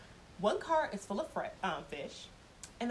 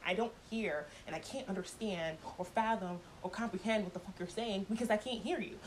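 ordinary delivery

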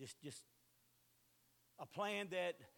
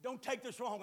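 normal talking voice

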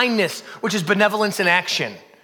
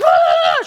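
loud, high hammed-up shriek; high panicked shriek; 7 dB louder than usual. Pastor acting his meltdown, high shriek. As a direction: shriek like you've lost it. Freaking out louder.

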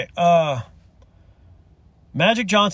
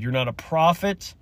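ordinary talk

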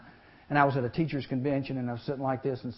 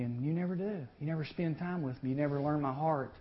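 ordinary talk, nothing marked

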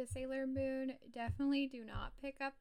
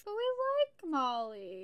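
drawn-out, higher, louder plea; insistent protest; 6 semitones higher, 6 dB louder than usual. Real, not acted. Co-host defending Molly against criticism, drawn-out insistent protest. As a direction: plead it again, stretching the words out. Repeats the defence over objection.

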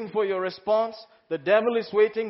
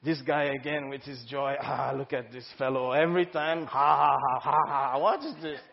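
grumbling skeptic; 5 semitones lower than usual. Retold or imitated. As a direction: play the annoyed churchgoer. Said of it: "it may become one kind".

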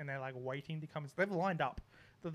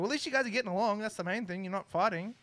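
higher and louder, teasing; cheeky mock reassurance; 6 semitones higher, 5 dB louder than usual. Teasing the embarrassed neighbour, cheeky mock reassurance. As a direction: rub it in with fake cheer.

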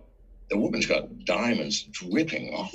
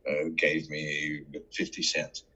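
normal talking voice

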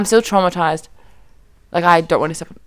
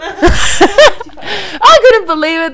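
high, drawn-out words through laughter; laughing disbelief; 10 semitones higher, 4 dB louder, much slower than usual. Real, not acted. Storyteller tells friends of the fine, laughing. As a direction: let laughter stretch the words out.

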